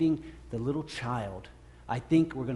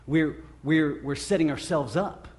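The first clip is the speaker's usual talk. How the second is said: ordinary talk, nothing marked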